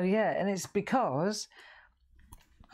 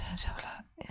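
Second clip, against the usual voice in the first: soft whispered aside; 2 semitones higher, 10 dB quieter than usual. Real, not acted. Crafter quietly checking her work, whispering. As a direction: whisper it, much softer. Muttering to herself while drawing.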